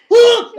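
sudden high loud grunt, imitated; mock grunt. Imitating the neighbour's loud straining grunt.

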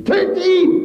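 piercing, very high, loud scream; mad shriek. Retold or imitated. Wife screams madly for her husband's death, shrieking. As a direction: shriek it with wild frenzy. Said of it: "wie wahnsinnig schrie sie".

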